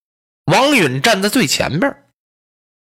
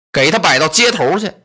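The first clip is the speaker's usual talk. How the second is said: ordinary delivery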